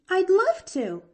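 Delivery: put-on very high, loud girlish delight; exclaiming eagerly. A narrator's reading. Girl accepting the doctor's invitation, exclaiming eagerly. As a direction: burst out eagerly. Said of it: "exclaimed Pollyanna".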